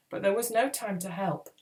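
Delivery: plain reading voice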